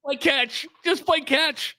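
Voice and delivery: high mock-encouraging parent calls; sideline cliches. Parent calling cliches to their kid on the mound, mock encouraging. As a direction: rattle off cliches like a hovering parent.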